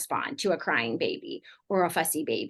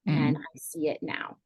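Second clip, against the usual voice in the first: softer, slow and sincere; sincere apology; 5 dB quieter, slower than usual. Retold or imitated. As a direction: gently own it, no excuses. Parent sincerely owning the hurt.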